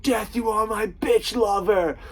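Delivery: high, loud, drawn-out cry; mock defiant movie yell. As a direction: holler it slowly, hammed up, at the ocean. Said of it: "He's like".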